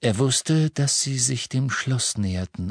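ordinary delivery, nothing marked

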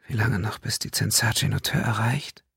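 breathless, yearning whisper; longing whisper. Acted. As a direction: whisper it with desire. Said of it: "fragte Sandesio verlangend".

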